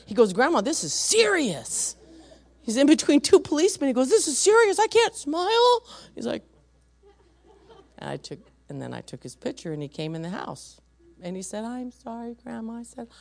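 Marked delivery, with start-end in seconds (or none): 0.3-2.0 put-on high, loud, drawn-out child's protest; mock child indignation; indignant little boy. Voices grandson's indignant protest. 4.1-5.8 very high, loud mock child voice; hammed-up child's protest, stretched at the end; indignant little boy. Voices grandson's protest again. 11.6-12.8 put-on soft, high little-boy voice; meek, hushed sorry in a kid's voice; subdued child's apology. Voices grandson's later, calmer apology.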